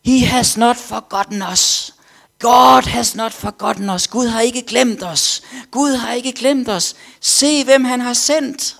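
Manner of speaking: high, loud, slow proclaiming shout; slow, high, loud preacher's cry to the crowd. Retold or imitated. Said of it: "så råbte han".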